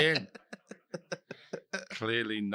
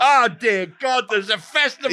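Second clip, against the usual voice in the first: mock horror; 9 semitones higher, 5 dB louder than usual. Real, not acted. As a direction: act appalled at the news. Horrified at the festival news.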